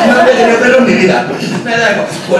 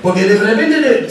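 ordinary talk

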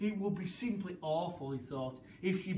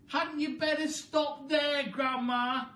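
higher, slower anxious plea; worried boy; 9 semitones higher, 6 dB louder, slower than usual. A narrator's reading. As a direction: coax her to stop. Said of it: "George said".

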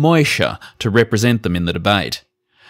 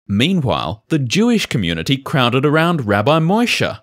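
usual speaking voice

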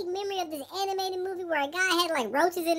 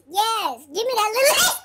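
mock scream; 4 semitones higher, 5 dB louder, slower than usual. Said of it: "she screams".

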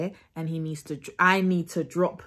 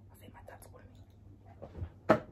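barely audible secretive whisper; very soft hushed aside; 11 dB quieter, much faster than usual. Real, not acted. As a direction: drop out of the act and whisper. Secret aside during fake call.

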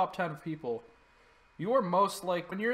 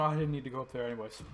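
normal talking voice

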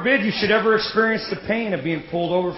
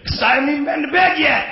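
shouted excuse; 8 semitones higher than usual. Retold or imitated. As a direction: blurt it out at full volume. Truthful answer to boss.

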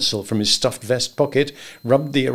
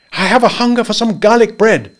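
higher, louder, lively; a bright house cook; Lee's voice; 10 semitones higher, 7 dB louder than usual. A narrator's reading. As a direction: announce a craving cheerfully. Lee speaking.

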